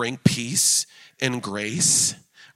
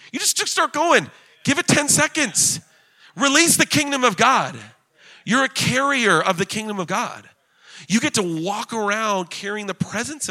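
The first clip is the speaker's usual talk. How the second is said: high, emphatic preacher's exhortation; fired-up preaching; 8 semitones higher than usual. Real, not acted. Preacher urging the congregation to just start praying, fired-up exhortation. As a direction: urge them on, pitched high and punchy. Preacher urges listeners to pray.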